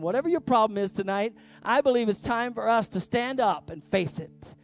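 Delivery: usual speaking voice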